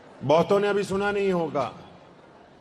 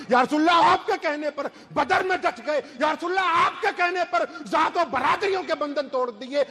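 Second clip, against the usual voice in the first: high, fervent, rhythmic appeal; impassioned profession; 5 semitones higher than usual. Acted. Companion professes total faith to the Prophet, impassioned. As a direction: plead with raised pitch, lifting each address.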